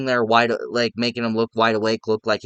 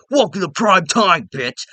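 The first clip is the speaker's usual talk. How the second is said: high, loud mocking villain taunt; Freddy taunt; 7 semitones higher, 4 dB louder than usual. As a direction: sneer it like a gleeful killer. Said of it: "and he's like".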